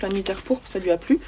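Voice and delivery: ordinary talk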